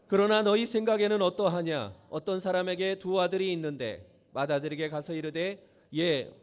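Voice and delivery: measured reading of a Bible passage, steady and solemn; scripture reading. Acted. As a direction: read the verses aloud to the congregation. Reads scripture passage aloud. (성경 봉독하듯 차분하게)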